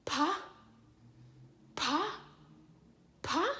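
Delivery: "pa" as separate airy lip pops; P sound demo. Shown as a demonstration. Teacher checking the child said the sound, P sound demo. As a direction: puff out each "pa", the last one rising. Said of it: "say your P sound by saying".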